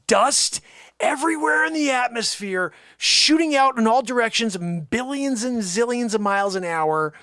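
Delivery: loud, high excited play-by-play; hammed-up action narration; excited telling. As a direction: tell it like a blockbuster explosion. Excited retelling of explosion.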